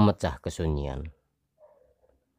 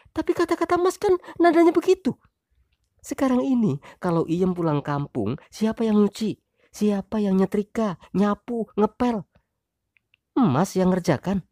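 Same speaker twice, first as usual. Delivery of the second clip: complaining wife. Acted. Wife listing chores to husband, high voice.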